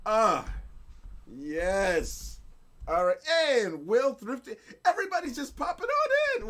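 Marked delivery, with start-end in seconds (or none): 4.9-6.5 high, loud, quick and laughing; excited squeal. Real, not acted. Streamer seeing everybody popping on in, excited high squeal. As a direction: let pitch leap with delighted surprise. Delighted as viewers keep arriving.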